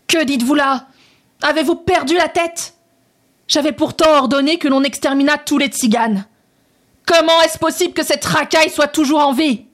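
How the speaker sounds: high, raging royal outburst at the general; furious shout. A narrator's reading. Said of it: "Furieux, il cria".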